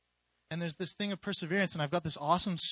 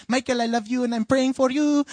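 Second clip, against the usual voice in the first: higher, louder imitation of a Filipino mother; Filipino mom accent; 6 semitones higher, 8 dB louder than usual. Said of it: "She's, like, Filipino".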